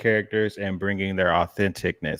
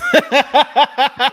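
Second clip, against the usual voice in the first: loud high laugh; 10 dB louder than usual. Real, not acted. Cohost cracking up at her partner's joke, a loud high laugh.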